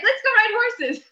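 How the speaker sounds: high, loud enthusiastic invitation; excited guide. Retold or imitated. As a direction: sell it like the best idea ever. Excited guide proposes riding.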